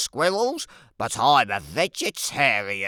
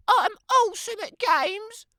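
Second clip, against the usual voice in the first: put-on much higher, sing-song girlish voice; a sweet, self-admiring child's voice; vain little girl; 5 dB louder than usual. Little Red voiced by narrator.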